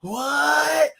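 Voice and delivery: loud, drawn-out dopey surfer. Retold or imitated. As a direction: play it like a spaced-out surfer dude. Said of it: "that surfer voice".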